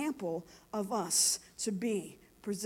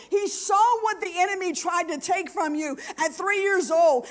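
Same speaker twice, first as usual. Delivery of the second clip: high, loud, urgent testimony; impassioned preaching; 7 semitones higher, 8 dB louder than usual. Real, not acted. Preacher retelling what she told a hurting woman, impassioned preaching. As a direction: drive each line home from the pulpit. Preaches a retold encounter at full force.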